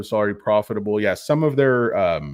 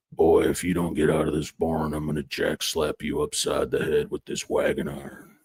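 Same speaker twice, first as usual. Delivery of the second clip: low, gruff cowboy growl; Sam Elliott growl; 6 semitones lower, 3 dB quieter than usual. Pretend. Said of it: "Let's get a mysterious growl".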